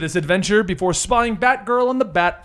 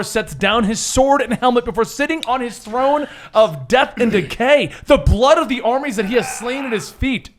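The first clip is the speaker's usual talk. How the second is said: epic narration; 4 semitones higher than usual. A narrator's reading.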